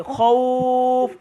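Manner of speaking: খৌফ with the ঔ held for one alif, slowly; stretched vowel. Shown as a demonstration. Teacher demonstrating recitation to the class, drawing out খৌফ. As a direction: draw out the middle of খৌফ for about a second. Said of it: "এখানে আমি কিন্তু একটু টান দিচ্ছি, এক আলিফ পরিমাণ".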